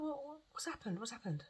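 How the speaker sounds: high, soft worried question; retold concern. Retells her worried repeated question.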